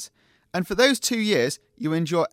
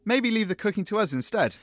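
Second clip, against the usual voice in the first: ordinary talk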